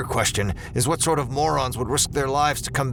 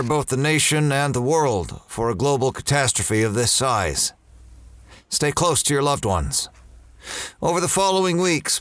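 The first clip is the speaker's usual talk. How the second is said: raised, commanding speech to the public; formal address; 4 semitones higher than usual. Acted. General briefing the world on evacuation, commanding. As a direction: address the nation with authority.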